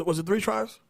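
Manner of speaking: ordinary talk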